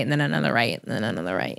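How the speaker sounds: fading away. Real, not acted. Guest recalling driving away ashamed, fading low and soft. Trailing comic fade.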